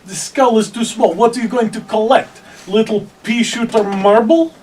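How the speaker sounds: scornful in-character taunt. Acted. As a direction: scoff loudly at such puny trophies. Player scoffs in character at kobolds.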